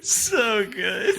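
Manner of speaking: crying with laughter; laughing through the words; laughing hard. Real, not acted. Laughing hard after joke.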